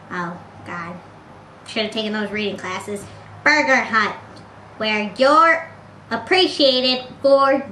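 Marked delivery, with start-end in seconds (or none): none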